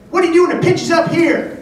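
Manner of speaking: high, loud quizzing coach; shouted-up impression of a baseball legend; Ted Williams. Baseball legend quizzing supposed pitcher, high loud impression. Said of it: "he goes".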